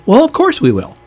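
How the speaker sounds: high-pitched, breezy imitation of his wife; wife's breezy reply. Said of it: "She says".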